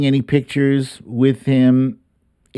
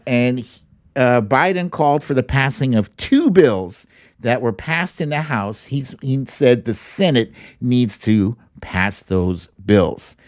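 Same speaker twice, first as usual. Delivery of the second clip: normal talking voice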